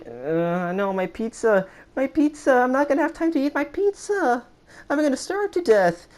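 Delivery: very high, panicky whining voice; panicked whine. Retold or imitated. Kid panicking to the monitor about his pizza, squealing high. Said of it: "I was like".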